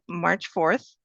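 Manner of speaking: ordinary talk, nothing marked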